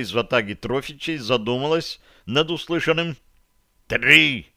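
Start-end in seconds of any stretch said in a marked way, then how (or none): 3.9-4.5 loud, abrupt bark; sudden forceful shout; barked count. A narrator's reading. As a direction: bark the number like a command. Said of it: "рявкнул Барри".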